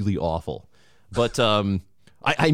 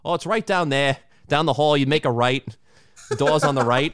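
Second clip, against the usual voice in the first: higher-pitched imitated Boston accent; 6 semitones higher than usual. Imitating an airport security lady giving bathroom directions, Boston accent. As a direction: play a Boston local giving directions, R's dropped. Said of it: "had that Boston accent".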